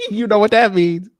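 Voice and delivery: high, loud, through laughter; real laughing. Real, not acted. Guest mocking a streamer's audio excuse, laughing. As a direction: crack up while saying it.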